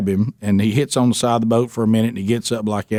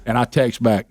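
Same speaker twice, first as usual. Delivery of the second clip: ordinary talk, nothing marked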